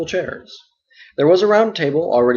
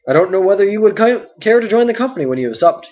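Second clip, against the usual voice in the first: a louder, bustling landlord voice; hearty and full of chatter; talkative innkeeper Butterbur; 6 semitones higher, 6 dB louder than usual. Acted. Butterbur invites them.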